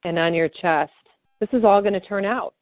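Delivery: calm, steady guided affirmation read; guided tapping script. Acted. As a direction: lead the listener gently through each tapping point.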